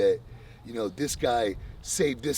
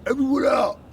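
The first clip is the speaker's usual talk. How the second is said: much higher, louder, hammed-up shout; mock command shout; 12 semitones higher, 8 dB louder than usual. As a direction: bark the room empty in jest. Imagining himself ordering everyone out.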